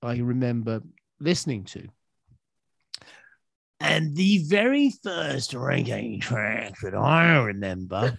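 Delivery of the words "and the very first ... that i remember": slow, drawn-out actorly delivery; hammed-up, ponderous movie-actor voice; Russell Crowe. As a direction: declaim it slowly like a film star. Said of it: "is that Russell Crowe or you".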